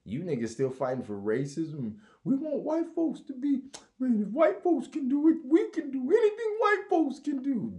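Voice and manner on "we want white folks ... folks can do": high, loud put-on preacher voice; mock activist voice. Mocking activists, a high loud rally voice. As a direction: ham up a rally speech.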